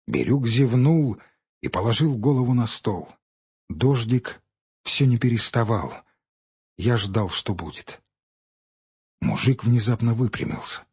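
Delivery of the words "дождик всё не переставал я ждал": plain reading voice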